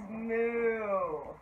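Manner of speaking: long cow moo, dropping away; drawn-out low-key moo; cow's moo. Shown as a demonstration. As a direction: show children how a cow moos. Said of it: "The cow says".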